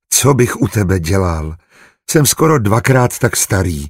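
plain reading voice

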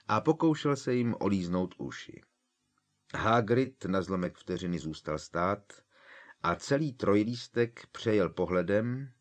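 plain reading voice

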